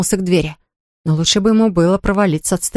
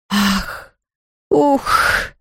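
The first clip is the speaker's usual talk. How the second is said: ghost sobbing. A narrator's reading. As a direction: sob like a ghost. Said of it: "всхлипывало привидение".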